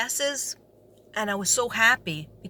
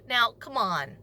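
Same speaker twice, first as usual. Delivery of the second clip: usual speaking voice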